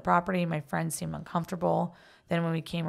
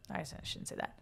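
hushed whisper to herself; whispered aside; 12 dB quieter, faster than usual. Real, not acted. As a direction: drop to a whisper, half to herself. Aside, takes back her words.

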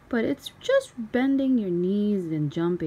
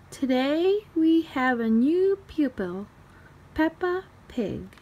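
higher, louder teacher announcing; Madame Gazelle; 6 semitones higher, 4 dB louder than usual. A narrator's reading. Ballet teacher introduces Peppa to the class, raised voice. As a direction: present the new pupil to the class.

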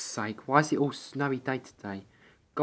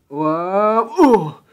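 alarmed shriek; 8 semitones higher, 10 dB louder than usual. Acted. Rolling away in snowball.

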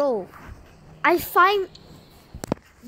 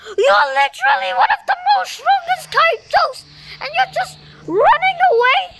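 much higher and louder, half-crying yell; outraged shout; 12 semitones higher, 5 dB louder than usual. Real, not acted. Gamer screaming at the strongest kaiju for running away, outraged. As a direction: scream it at the fleeing monster in disbelief.

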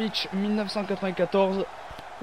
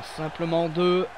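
normal talking voice

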